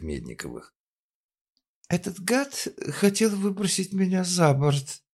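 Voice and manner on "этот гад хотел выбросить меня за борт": moaning. Acted. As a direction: wail it like a drunk victim. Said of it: "простонала она".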